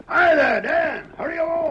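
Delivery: loud high hail across the camp; calling out. Acted. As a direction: shout him over, urgent. Calling out to arriving rider.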